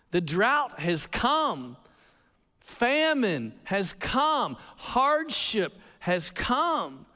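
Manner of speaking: slow, weighty, each line drawn out; solemn drawn-out pronouncement; slow dramatic refrain. Acted. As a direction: let each line land like a verdict. Preacher intoning dramatic refrain.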